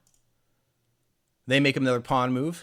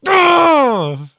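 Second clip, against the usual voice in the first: loud groan cry; 12 semitones higher, 10 dB louder than usual. Real, not acted. Reaction after the slammed moves.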